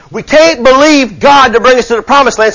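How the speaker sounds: rising preacher's cry; fervent preaching. Acted. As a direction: preach it with fire to the church. Preaching at full force.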